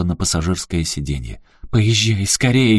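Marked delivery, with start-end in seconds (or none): 1.7-2.8 медленный громкий приказ; прикажи ехать; велела. Acted. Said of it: "велела она". (Аляска велит Эрику ехать)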